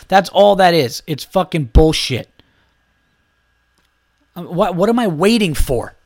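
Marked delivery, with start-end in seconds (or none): none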